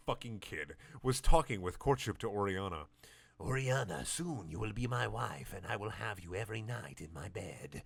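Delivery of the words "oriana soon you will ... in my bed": much softer sleazy villain; lustful villain. Acted. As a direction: purr it with evil desire. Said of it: "said Video Santiago with lustful evil".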